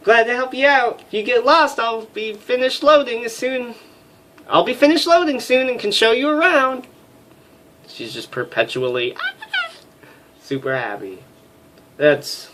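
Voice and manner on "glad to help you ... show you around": much higher put-on woman's voice; female voice. Flight officer greeting the major, high girly voice. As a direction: squeak it out as a chirpy young woman. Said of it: "female voices".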